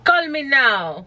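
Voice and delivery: theatrical fortune-teller acting; hammed-up hotline psychic. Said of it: "what you saw was my best acting work".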